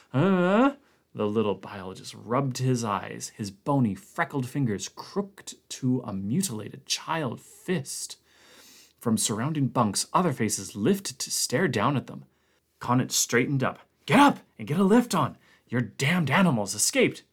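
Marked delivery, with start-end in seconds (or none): 0.1-0.7 startled huh. Acted. Blair startled awake. 14.0-17.2 urgent order. Acted. Connant urging Blair up.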